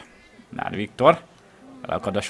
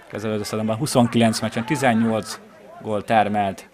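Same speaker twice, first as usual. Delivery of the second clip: normal talking voice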